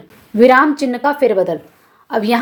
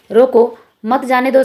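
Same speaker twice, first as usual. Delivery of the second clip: "रोको", clear pause, then "मत जाने दो"; slowly, breaking between रोको and मत; comma after रोको. Shown as a demonstration. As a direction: set रोको apart as the answer. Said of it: "सही होगा".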